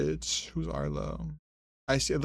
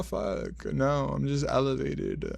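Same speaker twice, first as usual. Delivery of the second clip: normal talking voice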